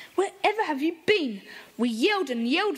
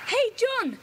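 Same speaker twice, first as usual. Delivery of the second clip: calling across the garden, higher; 8 semitones higher than usual. Acted. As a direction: hail the gardener from the gate. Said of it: "Peter gave him a shout".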